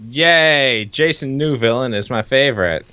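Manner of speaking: ordinary talk, nothing marked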